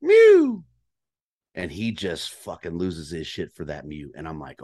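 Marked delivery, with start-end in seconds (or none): 0.0-0.6 much higher, louder cow-elk mew; a short squeaky call to the bull; imitated elk mew. Hunter showing the call he made, imitated elk mew. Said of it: "So, mew".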